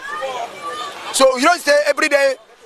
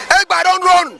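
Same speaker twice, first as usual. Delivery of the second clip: Egba don't run, higher and louder, "run" falling hard; emphatic call; 5 semitones higher, 4 dB louder, slower than usual. Acted. Ranting at Biafra supporters, higher and louder. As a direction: belt the refrain out like a verdict. Refrain repeated at peak of rant.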